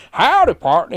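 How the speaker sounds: mocking Brits doing an American accent; fake American accent. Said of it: "try to do American accents".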